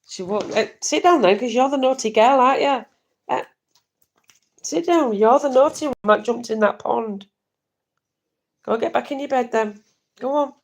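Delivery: high, loud telling-off; scolding the dog. Real, not acted. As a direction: scold a naughty pet.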